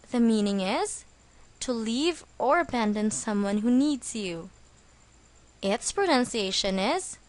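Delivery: ordinary delivery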